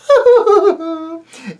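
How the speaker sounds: high, light silver laugh; silvery laugh. A narrator's reading. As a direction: laugh softly and sweetly. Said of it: "her soft silver laughs".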